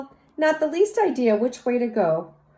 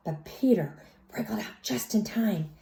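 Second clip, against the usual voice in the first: whisper; 6 dB quieter than usual. A narrator's reading. Suspenseful escape in story.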